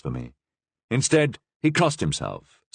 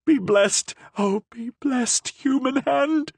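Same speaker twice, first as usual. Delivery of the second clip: high, ecstatic cries; cried out. A narrator's reading. Prisoner crying out over the Cardinal's hand, high and ecstatic. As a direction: bless the hand in wonder. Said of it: "she cried".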